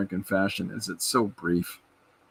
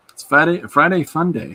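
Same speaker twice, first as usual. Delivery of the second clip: higher, louder sing-song chant; cheery catchphrase; 7 semitones higher, 6 dB louder than usual. Real, not acted. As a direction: announce it like a weekly jingle. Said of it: "I started saying Fridays are fun days".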